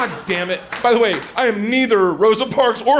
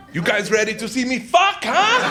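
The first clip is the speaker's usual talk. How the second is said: creepy show-off; 3 semitones higher than usual. Acted. Creepy guy working the crowd at a sex show, leering. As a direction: goad the crowd like a creepy performer. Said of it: "It's creeping me out".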